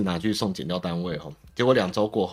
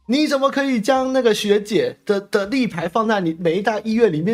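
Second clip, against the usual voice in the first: much higher, louder mock scolding; a councilor scolding the mayor, in a mocking high voice; 13 semitones higher, 4 dB louder than usual. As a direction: lampoon the councilor lecturing the mayor. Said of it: "就他们在骂柯文哲嘛".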